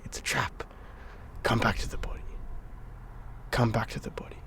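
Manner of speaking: hushed whisper to himself; urgent inner whisper. Retold or imitated. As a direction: whisper it like a secret warning to yourself. Inner voice during breathwork.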